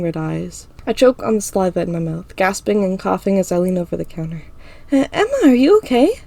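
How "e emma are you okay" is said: put-on high, anxious girl voice; worried friend. A narrator's reading. Ira seeing Emma choke, high and worried. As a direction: ask in alarm, high.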